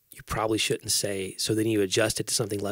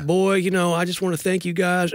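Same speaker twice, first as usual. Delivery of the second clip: high-pitched, put-on pastor's welcome; mock sermon small talk; 7 semitones higher than usual. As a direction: parody a preacher warming up the congregation. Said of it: "when you're doing a sermon".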